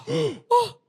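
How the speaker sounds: high, airy gasp of awe; hammed-up thrilled gasp; delighted gasp. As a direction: mimic a starstruck "oh". Wife thrilled by British accent.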